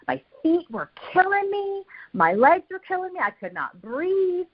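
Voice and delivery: high-pitched, hammed-up exhausted complaint; dramatized agony. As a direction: wail it in mock agony, stretching the last words. Retelling agony of learning to jog.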